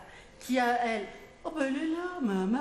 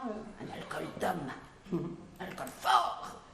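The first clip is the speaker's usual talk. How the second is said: mock macho voice; 7 semitones lower, 4 dB quieter than usual. Mock manly drink order.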